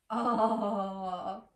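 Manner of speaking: usual speaking voice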